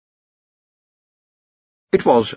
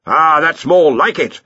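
higher, louder drawn-out approval; cheerful; 7 semitones higher, 6 dB louder, slower than usual. A narrator's reading. Arch-Chancellor seizes on the lecturer's remark, cheerful. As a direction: welcome it heartily. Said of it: "said Ridcully cheerfully".

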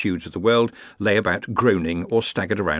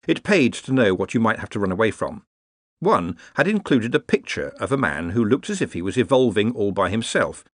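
ordinary delivery, nothing marked

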